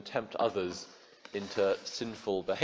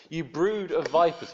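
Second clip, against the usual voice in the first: calling out; 7 semitones higher, 5 dB louder than usual. Retold or imitated. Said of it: "he calls them".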